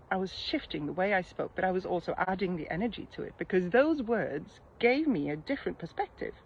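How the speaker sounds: usual speaking voice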